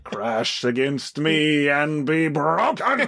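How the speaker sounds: slow booming villain proclamation; Apocalypse voice. Retold or imitated. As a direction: declaim like an ancient conqueror. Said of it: "some [name] voices on this podcast".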